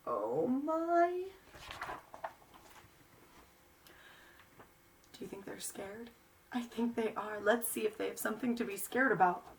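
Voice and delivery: put-on lively read-aloud for small children; story reading. A narrator's reading. Reads picture book to children.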